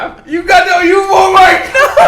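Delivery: high loud embarrassed laughing cry; loud high laughing panic; mortified laugh-shriek. Real, not acted. Horrified the audio exists.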